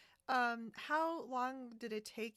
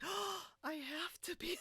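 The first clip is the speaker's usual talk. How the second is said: excited gasp; 10 semitones higher than usual. Retold or imitated.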